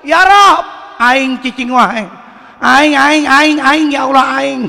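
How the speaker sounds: tiruan logat Sunda, keras dan tinggi; doa orang Sunda. Retold or imitated. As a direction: meniru orang Sunda berdoa, aing diulang-ulang. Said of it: "Orang Sunda boleh". (logat Sunda yang dilebih-lebihkan)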